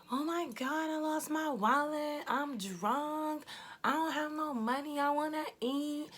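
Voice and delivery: high, whiny imitation of a drunk friend; mocking a drunk friend wailing in the store; mock drunk girl. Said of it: "yelling, up and down through the Wawa".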